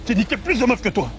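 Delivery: high, offended protest; indignant retort. Acted. Man defends his sexuality to friend, indignant. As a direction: fire back with stung pride.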